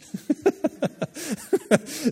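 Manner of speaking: high burst of laughter. Real, not acted. Preacher laughing at his own joke about spouses, high burst. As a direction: crack up at your own joke.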